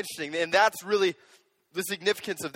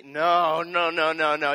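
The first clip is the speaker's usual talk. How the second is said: normal talking voice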